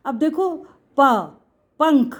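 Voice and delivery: ordinary delivery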